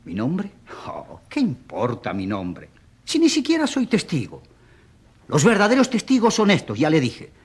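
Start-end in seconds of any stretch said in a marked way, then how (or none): 0.0-2.7 soft, slow, half-whispered reply; low, slow, breath-heavy deflection; dismissive musing. Acted. Brushes off question about his name. 3.0-6.8 high, agitated insistence; exclaimed, high-pitched objection; insistent protest. Acted. Insists he is no witness.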